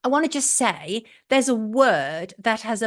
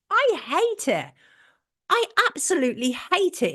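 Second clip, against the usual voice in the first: sharp higher burst of disgust, I hate it; real disgust; 7 semitones higher than usual. Real, not acted. Host venting to viewers about the word normies, disgusted. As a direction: spit out how much you hate it.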